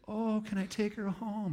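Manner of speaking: gushing cat lady asking to adopt; pleading cat lady. Retold or imitated. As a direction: ask sweetly for the cat. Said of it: "And she said".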